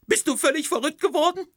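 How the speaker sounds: very high, loud indignant wife; wife confronting Rick about the skeleton, very high and loud; outraged wife. Acted. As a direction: demand an answer at full pitch. Wife's shrill outrage.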